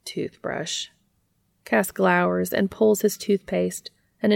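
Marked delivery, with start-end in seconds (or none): 0.0-0.9 whispered. Acted. Dean's teasing one-word demand.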